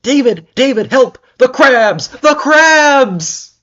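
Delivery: high, loud panicked screams; screaming for help. Acted. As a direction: scream it in terror. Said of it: "Suddenly I heard screams".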